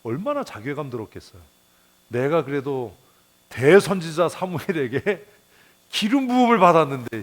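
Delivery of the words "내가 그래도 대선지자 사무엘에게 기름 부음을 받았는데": louder and higher, building with offended pride; indignant outburst. Retold or imitated. Preacher voicing Saul's offended pride, rising indignant cry. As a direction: swell up like a king insulted.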